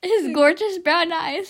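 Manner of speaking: ordinary talk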